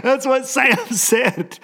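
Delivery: high, drawn-out words through laughter; helpless laughing delivery; laughing hard. Real, not acted. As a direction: crack up while trying to finish. Cracking up at his own story.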